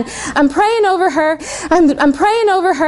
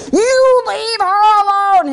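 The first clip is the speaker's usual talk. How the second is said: slow, higher, commanding rebuke to the demon; big commanding voice; 6 semitones higher, slower than usual. Retold or imitated. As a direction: command the spirit to leave, drawn out. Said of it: "In this big voice, it's not my own".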